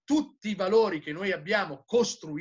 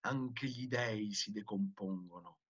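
very low, soft, grave voice; hushed aside; 10 semitones lower, 13 dB quieter than usual. A narrator's reading. Madman on the rotting of gods, grave hushed voice. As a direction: sink suddenly to a near murmur. Sudden hush within madman's speech.

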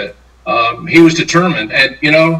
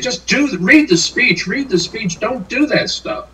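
pleading; 8 semitones higher, 4 dB quieter than usual. Retold or imitated. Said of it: "kept handing it to him and saying".

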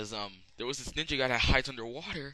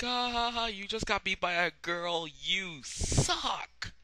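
high, gloating mock jeer; mocking taunt; 8 semitones higher, slower than usual. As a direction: crow it in a high, teasing voice. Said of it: "I'm like".

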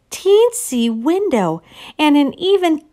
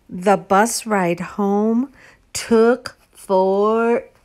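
usual speaking voice

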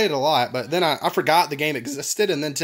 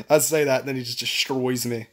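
usual speaking voice

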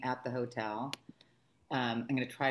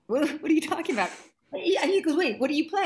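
higher, louder and quicker bafflement; quick, incredulous quoted question; mock baffled; 12 semitones higher, 6 dB louder than usual. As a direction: imitate someone thrown by a strange claim. Puzzled musician's quoted reaction.

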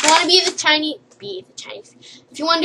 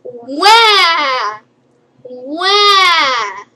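fake wailing; 3 semitones higher, 9 dB louder than usual. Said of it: "and be like".

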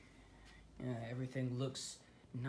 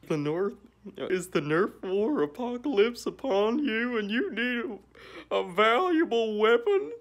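loud, pushy ad-pitch voice, climbing higher; mock infomercial; 9 semitones higher, 12 dB louder than usual. Pitching the family's junk to viewers, hammed-up ad read.

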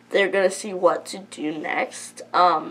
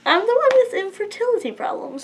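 real crying, voice high and rushed; tearful, words tumbling out; 10 semitones higher, faster than usual. Real, not acted. Vlogger admits her infertility to viewers, crying. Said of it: "sorry about crying".

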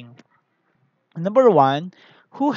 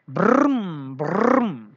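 mock engine noise; 4 dB louder than usual. Toy cars come alive.

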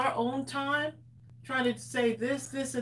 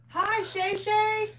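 singsong greeting; 9 semitones higher, slower than usual. Acted.

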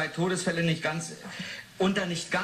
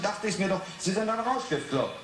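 usual speaking voice

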